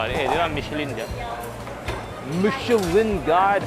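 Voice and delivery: usual speaking voice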